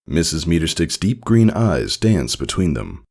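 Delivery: ordinary delivery, nothing marked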